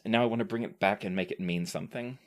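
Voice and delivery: ordinary talk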